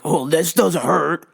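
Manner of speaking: strained put-on voice of a tough guy; mock denial of pain. Grappler imitating his stubborn opponent in a toe hold, mock strained denial. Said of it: "He's just looking at me like".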